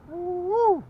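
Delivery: high, loud whoop of joy; excited whoop. Real, not acted. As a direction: let out a real whoop over the catch. Another crappie on the hook.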